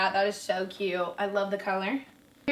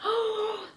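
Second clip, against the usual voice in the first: excited gasp; 12 semitones higher than usual. Real, not acted. Delighted at the pants.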